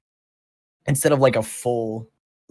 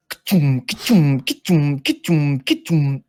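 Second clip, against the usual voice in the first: 1 stomping machine noise; 5 semitones higher, 5 dB louder, slower than usual. Retold or imitated. Exoskeleton footsteps imitated.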